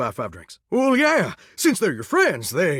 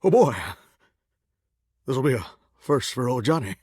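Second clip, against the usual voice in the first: ordinary talk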